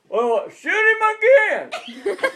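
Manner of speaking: slow, loud, high sneering call; mocking know-it-alls. Mocking the smarties up on the ridge, drawn-out jeer.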